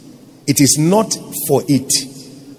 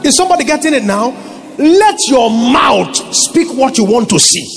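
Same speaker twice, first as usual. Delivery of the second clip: fiery preaching; 8 semitones higher than usual. Real, not acted. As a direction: preach it out with full force. Preaching at full force.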